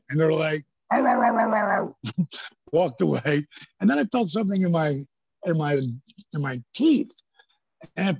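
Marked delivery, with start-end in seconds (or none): 0.9-1.9 mock angry ranting. Imitating angry wrestlers yelling at him, mock ranting. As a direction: rattle it off like an angry locker room.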